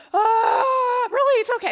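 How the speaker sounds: high, loud hammed-up outburst; mock wail. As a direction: cry out dramatically, then protest it's okay. Acting out overblown reaction to husband.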